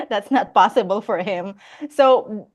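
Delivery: ordinary delivery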